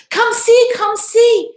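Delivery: high, loud eager call to everyone; a girl waking the house with news; excited calling. Acted. Hannah calling her family to come see, high and excited.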